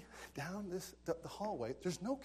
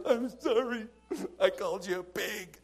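put-on higher, loud little-boy voice; cowed boy's apology; 5 semitones higher, 8 dB louder than usual. Boy apologizing to the officer before the school, cowed high voice. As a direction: imitate a boy forced to confess aloud.

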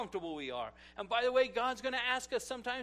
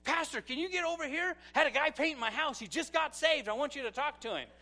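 high-pitched imitation of an eager lady; urgent, higher caller voice; excited lady caller; 5 semitones higher than usual. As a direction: play the church lady calling, voice pitched up. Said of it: "she calls again".

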